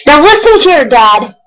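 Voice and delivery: storybook yell. A narrator's reading. Onceler yelling at the Lorax, a storybook shout. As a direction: shout it like the Onceler losing patience. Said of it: "I yelled at the Lorax".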